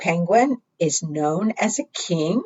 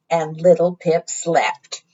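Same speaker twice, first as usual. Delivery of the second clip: ordinary delivery, nothing marked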